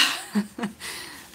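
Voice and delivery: an embarrassed little laugh; breathy laugh. Real, not acted. Laughs while recalling letter.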